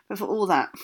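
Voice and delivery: ordinary delivery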